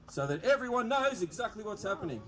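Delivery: usual speaking voice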